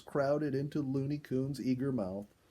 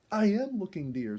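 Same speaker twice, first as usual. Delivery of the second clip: excited; 7 semitones higher, 4 dB louder than usual. A narrator's reading. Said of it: "as excited as her daughter".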